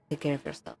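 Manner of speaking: a warden's last letter, read quietly. Acted. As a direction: read the journal page aloud, steady and solemn. Reading the journal letter aloud.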